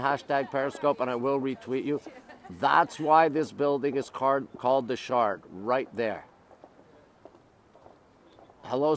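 ordinary delivery

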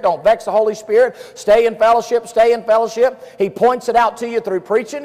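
high, loud, driving preacher; full-force preaching. Real, not acted. Preacher warning the congregation to stop sinning, loud and urgent. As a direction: hammer each repeated warning at the crowd.

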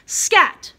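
high, loud storybook shout of Scat. Shown as a demonstration. Teacher showing pre-K kids how to read it loud. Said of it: "We say it loud".

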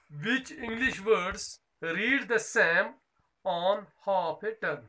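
very slow, every word spaced out and carried; slow dictation. Shown as a demonstration. Teacher reading the textbook question to her pupils, very slow dictation. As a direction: dictate the question so children can write it down.

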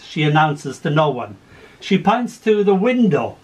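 lively story poem read aloud. Acted. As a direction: tell it with gentle humour. Reads a poem with voiced figures.

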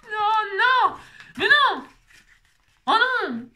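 high, loud cries of dismay; cri de dépit. Real, not acted. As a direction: wail it out in mock despair. Dépitée après une erreur. (cris aigus de dépit)

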